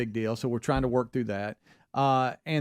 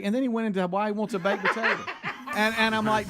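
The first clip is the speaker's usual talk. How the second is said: laughing; 6 semitones higher, faster than usual. Real, not acted. Laughing while retelling.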